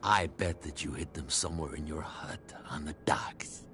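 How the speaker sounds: low, unvoiced accusing whisper. Secretive talk near warehouse.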